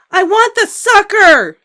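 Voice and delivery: very high, loud, slow tantrum scream. A narrator's reading. Little girl screams for the sucker, tantrum scream. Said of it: "screamed Ramona".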